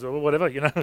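low, soft mock-dismissive mumble; offhand. Sceptical man answers the evangelist's healing offer, dismissively. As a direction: shrug it off quietly, imitating a sceptic.

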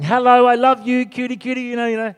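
put-on high, cooing baby talk; doting mum. Mum playing with her one-year-old, doting and high. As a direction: gush sweetly to a baby in a high voice. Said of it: "stimulating the child, saying".

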